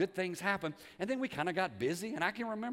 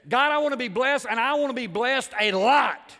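loud, high, emphatic plea; Jabez's bold prayer; 7 semitones higher, 13 dB louder than usual. Acted. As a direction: cry it out boldly, drawing out the end. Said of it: "Here's what he said".

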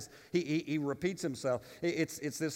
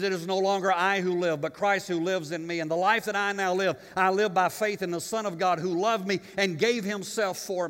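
scripture ringing out a bit louder; preaching; 5 semitones higher, 6 dB louder than usual. Real, not acted. Pastor quoting Galatians to his flock, lifted preaching voice. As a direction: proclaim the verse to the church.

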